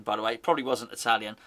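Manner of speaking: ordinary talk, nothing marked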